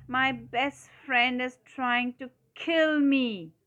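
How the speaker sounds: high, loud, slow melodramatic wail; drawn-out plea, stretched on the end; crying out. A narrator's reading. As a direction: cry out in exaggerated storybook alarm. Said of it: "cried Toad".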